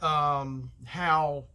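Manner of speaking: ordinary talk, nothing marked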